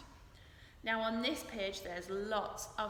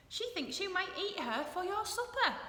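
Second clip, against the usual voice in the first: a higher, brighter bee voice; lightly voiced Bee; 9 semitones higher, 4 dB louder than usual. A narrator's reading. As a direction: read Bee a little higher.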